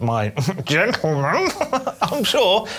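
slow, with a laugh breaking in; mock-proper patient. Pretending to tell the doctor, prim and giggling. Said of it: "if you went into the doctors and you said".

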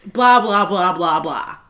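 loud blahs sliding down in pitch; mock dismissive. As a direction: brush off the apology, starting loud and sinking. Brushing off her retold apology.

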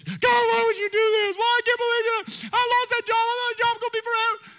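high, loud whiny complaint to God; mock despair. Pastor mimicking congregant wailing at God, mock despair. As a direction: ham up a crushed, wailing believer.

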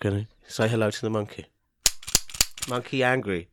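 low, soft, halting delivery; odd artist with monkey. Pretend. Artist interrupting to introduce the monkey, quiet and deadpan. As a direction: mumble it low as an eccentric artist.

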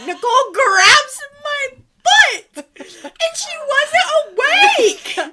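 loud high laughing shriek while telling it; shrieking with laughter. Real, not acted. Telling her roommates the scary part, laughing shriek. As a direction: scream the punchline through laughter. Scared telling climax, shouting.

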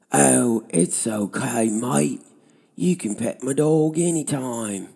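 high, loud hammed-up Australian; bad Australian accent. Driver forgiving a cyclist for petting his dog, bad Australian accent. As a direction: imitate a driver's terrible fake Australian accent. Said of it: "worst fake Australian accent I've ever heard".

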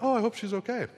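a mock anxious little voice; worried high voice. To himself waiting for her call, mock worried. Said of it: "I'm like".

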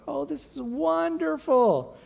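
high, slow, drawn-out gushing; stretched, high mock delight; imitating touched wife. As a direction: play the wife melting over a card. Voicing wife reading the card.

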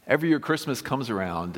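normal talking voice

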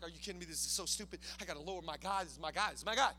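low, quiet, disbelieving grumble; distraught king, acted. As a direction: play a mildly hammed-up upset under the breath. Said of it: "He's distraught".

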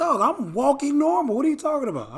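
high-pitched mock indignation; lightly acted exasperated objection; indignant protest. Replaying his protest at walking advice.

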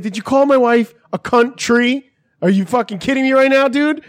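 acted road-rage indignation; retold outraged challenge. Retold confrontation over insult to wife.

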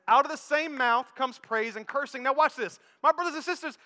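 emphatic preacher calling out answers; preaching at full force. Acted. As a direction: thunder the answer no to the church. Preaching climax.

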